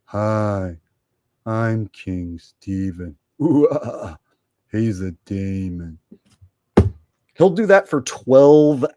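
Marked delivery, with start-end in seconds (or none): 0.0-6.0 slow, low mocking voice; impression of King Steven. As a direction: drag it out, low and dopey. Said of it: "the best impression of his loser ass".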